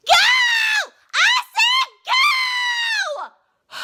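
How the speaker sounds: high piercing scream. Retold or imitated. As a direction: scream it at full force. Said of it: "how she was screaming".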